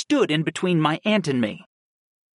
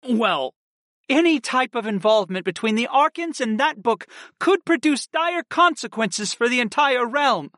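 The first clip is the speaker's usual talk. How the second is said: passionate; 10 semitones higher than usual. A narrator's reading. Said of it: "my aunt declared in a passionate tone".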